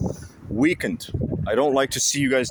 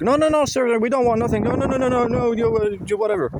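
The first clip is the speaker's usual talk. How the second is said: mocking scared stalkers; 11 semitones higher than usual. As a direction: squeak out a panicky retreat. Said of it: "And they're like".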